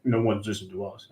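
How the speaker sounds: put-on quiet old-man tree voice; old grandpa voice. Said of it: "like this old grandpa voice".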